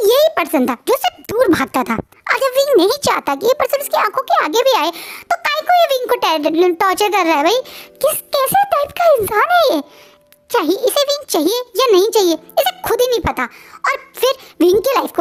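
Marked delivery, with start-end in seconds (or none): 1.9-13.4 high-pitched incredulous outburst; exasperated rant. Acted. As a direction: rant at him in disbelief. Narrator rants at the character.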